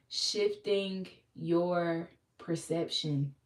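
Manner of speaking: very slow, every word stretched out; drawn-out preacher repeat; slow emphasis. Acted. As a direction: let each word sink in slowly. Repeats phrase slowly for weight.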